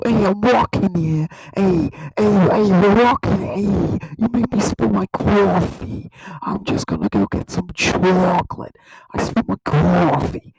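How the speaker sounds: mock New York accent with stretched vowels. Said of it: "the New York accent".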